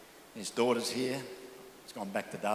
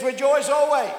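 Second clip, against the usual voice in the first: higher, louder proclamation; emphatic; 6 semitones higher, 9 dB louder than usual. Shown as a demonstration. Preacher correcting the mock misquote to the church, loud proclamation. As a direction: declare it with full force. True reading after mock ones.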